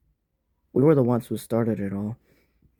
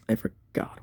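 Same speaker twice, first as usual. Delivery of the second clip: whispered to himself; unvoiced aside; 10 dB quieter than usual. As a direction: breathe it out, no voice. Repeats it under his breath.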